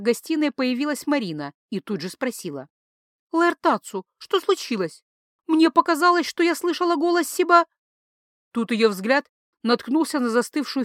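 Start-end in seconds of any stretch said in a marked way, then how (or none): 3.3-5.0 a hint of a higher woman's voice; lightly voiced woman. A narrator's reading. Marina voiced in story. 5.5-7.7 higher, louder woman's voice; anxious wife asking; worried wife. A narrator's reading. Marina voiced in story.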